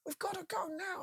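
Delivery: very high mock panic, voice cracking toward tears; desperate soldier. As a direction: beg him to move, near crying. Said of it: "and they're going".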